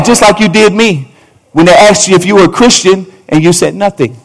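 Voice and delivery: ordinary talk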